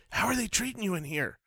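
soft hushed question to a dog; creepy whisper. Pretend. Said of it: "That's creepy".

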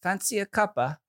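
put-on Cockney-tinged accent; Cockney-leaning British. Shown as a demonstration. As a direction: try British, veering into Cockney. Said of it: "you always veer to Cockney".

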